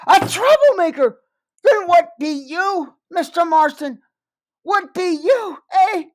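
high, loud indignant shout; furious outburst. Acted. Liddy leaps up and shouts at the overseer, furious. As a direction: fling it back at him, outraged.

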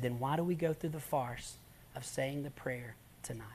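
soft whisper; whispered question. Retold or imitated. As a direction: hush it, gently blunt. Evangelist answers the girl gently.

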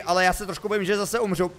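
ordinary talk

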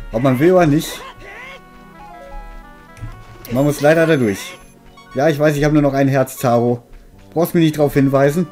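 usual speaking voice

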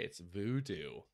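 ordinary talk, nothing marked

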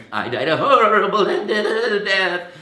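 loud, high, stuttering hammed-up terror; shaking monologue. Nervous student performing monologue on shaking legs, hammed-up quaver. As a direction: declaim it with the whole body trembling. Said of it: "shaking like this".

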